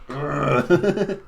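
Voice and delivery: loud strained grunt; effortful grunt. Acted. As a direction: grunt as you squeeze through. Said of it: "you're like".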